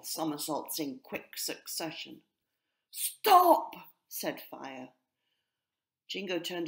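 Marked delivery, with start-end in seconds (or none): 2.9-3.7 very loud, high stop; commanding shout. A narrator's reading. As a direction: cut through the squabble. Fire shouts at the squabble.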